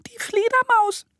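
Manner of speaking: squeaky, extremely high little bat; Flina. A narrator's reading. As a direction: introduce yourself in a tiny piping voice. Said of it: "antwortet eine piepsige Stimme". (piepsige Fledermausstimme)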